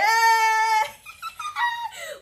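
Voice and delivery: a long, high, tearful laugh; overjoyed laugh breaking out; high crying laugh. Real, not acted. Creator overwhelmed by her YouTube award, crying laugh.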